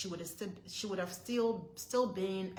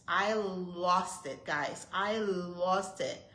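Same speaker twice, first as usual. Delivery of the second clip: slow, weighty, each word leaned on; slow emphatic; 4 dB louder, much slower than usual. Real, not acted. Vlogger telling viewers she lost it, slow and heavy. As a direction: stress how badly it went. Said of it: "that's when I lost it".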